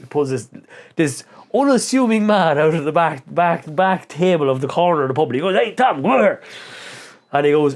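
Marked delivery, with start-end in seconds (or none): 5.6-6.4 higher-pitched acted hail; imitating a shout across a room; calling out. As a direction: call someone over from across the pub. Acted calling brother across pub.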